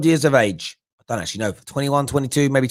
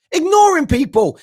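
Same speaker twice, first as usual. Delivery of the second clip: ignoring goes much higher and louder; heated outburst; 12 semitones higher, 5 dB louder than usual. Real, not acted. Host ranting to listeners about the manager, high and loud.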